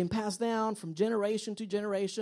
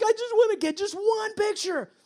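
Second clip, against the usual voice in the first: high, strained mock plea; frustrated parent; 11 semitones higher, 4 dB louder than usual. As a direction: plead in hammed-up exasperation. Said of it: "And you feel frustrated".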